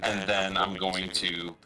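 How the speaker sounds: ordinary talk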